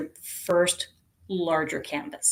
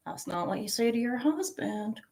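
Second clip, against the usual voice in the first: cheeky aside; 4 semitones higher, 8 dB quieter than usual. Retold or imitated. Cheeky joke reply.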